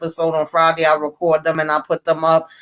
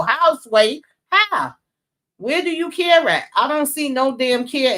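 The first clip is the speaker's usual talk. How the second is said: exasperated disbelief; 7 semitones higher than usual. Real, not acted. Ranting at mother on TV show.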